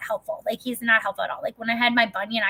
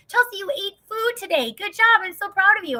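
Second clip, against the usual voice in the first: much higher and louder, sing-song praise; praising a child; 10 semitones higher, 4 dB louder than usual. Retold or imitated. Husband rewarding his wife like a little child, much higher. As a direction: overpraise her like a kindergartner. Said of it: "Or, like".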